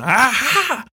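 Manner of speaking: cackle-taunt like a villain spinning a noisemaker; high mocking villain chant; gleeful taunting. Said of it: "and he's going".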